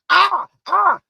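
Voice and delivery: "I, I" shouted high and loud; emphatic I, I. Acted. Preacher relishing God saying "I, I", loud and high. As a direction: hammer "I, I" with force. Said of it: "I love when he start putting them eyes in there".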